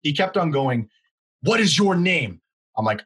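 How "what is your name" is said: higher, slow drawn-out demand; mock the customer's insistent, slowed-down question; imitating angry demand.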